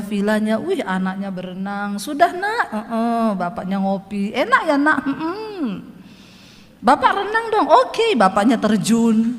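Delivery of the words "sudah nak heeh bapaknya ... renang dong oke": light high playful voices; cheerful family banter by the pool; voicing father and child. Villa keeper chatting with his child at pool, playful.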